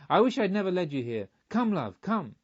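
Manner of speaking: alarmed, hurried plea; alarmed urging. A narrator's reading. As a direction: pull her away with urgent words. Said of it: "becoming truly alarmed".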